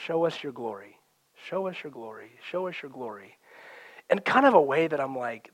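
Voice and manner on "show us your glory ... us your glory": hushed song quote. Retold or imitated. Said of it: "it just says over and over".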